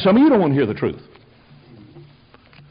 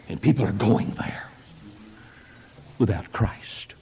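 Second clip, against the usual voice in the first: hushed warning; 8 semitones lower, 8 dB quieter than usual. Real, not acted. Sermon climax, dropping voice.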